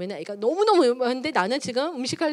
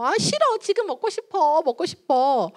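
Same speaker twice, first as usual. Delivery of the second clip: higher-pitched mock child's whine; whining child; 7 semitones higher than usual. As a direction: beg like a kid refusing to wait. Child whining for a snack, acted.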